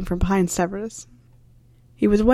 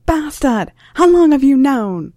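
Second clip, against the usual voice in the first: higher, much louder angry yell; furious shout; 6 semitones higher, 6 dB louder, slower than usual. Acted. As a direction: bellow it in outrage at the betrayal. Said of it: "Someone was shouting".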